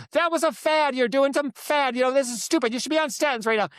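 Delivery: mock the doctor brushing it off in anger; mad doctor. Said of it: "And he was mad at me".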